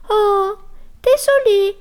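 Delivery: very high, tiny mouse voice saying sorry; squeaky mouse apology. Acted. Little mouse apologizing to the annoyed bear, very high squeak. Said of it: "s'excusa la souris".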